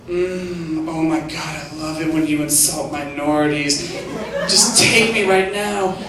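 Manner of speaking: breathy seductive purr, slow.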